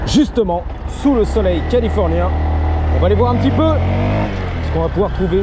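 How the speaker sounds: loud, high hype announcement; loud hyped. Real, not acted. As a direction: pump it up like a promo. Hyped sign-off.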